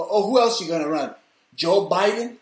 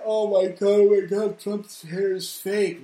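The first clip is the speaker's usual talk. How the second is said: mocking critic; 5 semitones higher, 3 dB louder, slower than usual.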